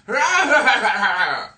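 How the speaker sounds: loud, high burst of cackling; mock laughter. Vampire group laughing at one passerby, mocking cackle. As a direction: imitate a group's jeering laugh. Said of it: "just start laughing at you".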